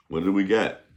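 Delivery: normal talking voice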